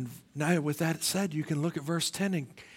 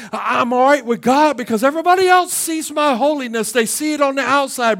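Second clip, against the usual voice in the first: smug shout; 8 semitones higher, 12 dB louder than usual. Retold or imitated. Pharisee's smug inner voice.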